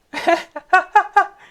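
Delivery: real burst of loud laughter; loud high laugh. Real, not acted. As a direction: cackle out loud. Laughs at the bite.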